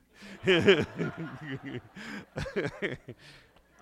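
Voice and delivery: hearty loud laugh; loud burst of laughter. Real, not acted. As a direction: laugh out loud at your own joke. Loud laugh mid-story.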